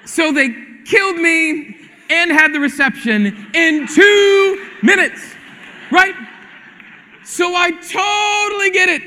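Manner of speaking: loud, high, slow indignant punchline; mock outrage. Real, not acted. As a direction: play up the outrage for laughs.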